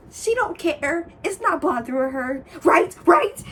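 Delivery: hammed-up imitation of her pressing for agreement; mocking pushy girl. Mocking the girl's pushy question.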